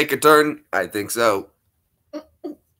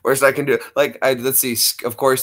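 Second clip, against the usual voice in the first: normal talking voice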